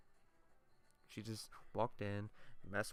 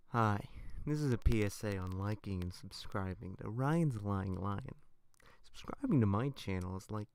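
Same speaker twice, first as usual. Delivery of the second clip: mock PSA ad read; 4 dB louder than usual.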